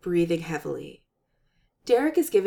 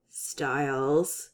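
growl; 6 semitones lower than usual. Acted. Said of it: "You can put that growl away".

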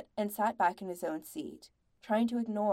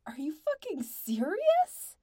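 high, drawn-out disbelieving demand; slow it down and pitch it up, outraged; incredulous anger; 8 semitones higher, 4 dB louder, slower than usual. A narrator's reading. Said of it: "Andy whirled on them".

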